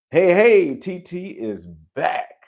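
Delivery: high, loud, drawn-out hail; whooping hello to a returning fan; excited welcome back. Real, not acted. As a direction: cheer a returning viewer, stretching the words out. Host hails a returning viewer.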